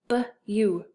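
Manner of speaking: "B-U" as a short buh, then long you; tapping out sounds. Shown as a demonstration. Teacher sounding out an open syllable, piece by piece. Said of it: "This is another open syllable".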